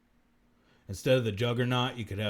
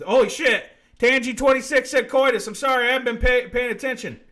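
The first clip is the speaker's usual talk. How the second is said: loud, higher-pitched apology; startled, flustered outburst; 13 dB louder than usual. Real, not acted. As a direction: blurt it out loudly in alarm. Sudden notice of missed chat message.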